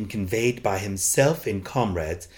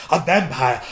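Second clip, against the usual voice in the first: loud horrified cry; crying out in horror; 5 semitones higher, 6 dB louder than usual. A narrator's reading. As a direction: shout it in horror. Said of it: "crying simultaneously, struck with horror".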